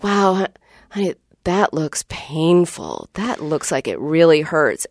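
tender, caring reply to a hurt daughter; validating sympathy. Retold or imitated. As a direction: acknowledge her pain gently. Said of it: "So I said".

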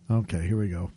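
ordinary delivery

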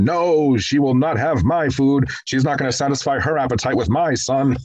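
high-pitched imitation of a scolding mother; raspy vicious mother. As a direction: mimic an emasculating, vitriolic old lady. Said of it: "this raspy voice".

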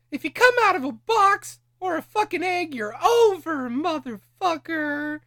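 high, loud, hammed-up yell; shouting. Real, not acted. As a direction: bellow it like a promo. Mock quoting wrestling adage.

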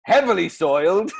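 slow, listing Welsh ad voice; Welsh accent. Acted. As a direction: lay out each option in Welsh tones. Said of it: "Bloody Welsh accent again".

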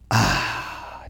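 soft, breath-only "ahh" of relief; relieved sigh. Acted. Overwhelmed person imagining relaxing, soft relieved sigh. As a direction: let out a long acted exhale.

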